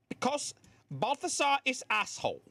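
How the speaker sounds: high, hammed-up accent, broken English; mock foreign accent. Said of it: "I don't know what that accent was".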